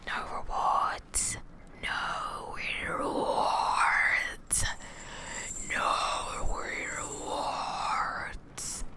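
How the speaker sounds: eerie teasing whisper. Acted. Demoness teasing her chat prisoners in a whisper. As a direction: breathe it out in a taunting whisper. Taunting whisper after loud line.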